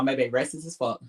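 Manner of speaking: normal talking voice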